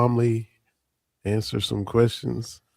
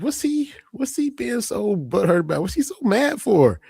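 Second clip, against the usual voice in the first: high-pitched, baffled questioning; mock wife voice, pitched way up in puzzled complaint; imitating his wife; 9 semitones higher than usual. Host quotes wife asking why he's so mad, high puzzled voice. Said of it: "she said".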